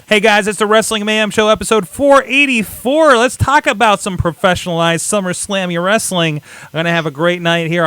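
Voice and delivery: show intro announcer. Acted. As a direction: kick off the show with energy.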